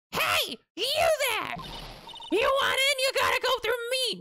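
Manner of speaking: high-pitched bossy gatekeeper; put-on character voice. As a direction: call out shrilly to block the way. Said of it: "I'm not doing this voice".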